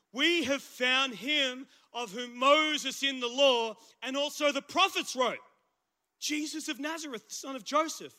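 bible reading with lifted voice; scripture reading. Acted. Reading Philip's words aloud.

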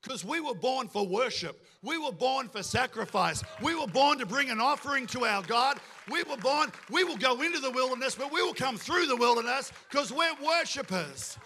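impassioned pulpit crescendo; rallying declaration. Real, not acted. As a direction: build from firm statement to full-voiced proclamation. Preacher builds to rallying declaration.

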